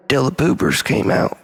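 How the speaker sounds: usual speaking voice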